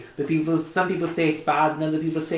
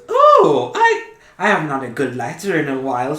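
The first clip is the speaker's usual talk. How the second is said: very high oh, then laughing; squealing, giggly delight; excited Sophie; 4 semitones higher, 8 dB louder than usual. Acted. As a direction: gush over a new letter. GM voices Sophie.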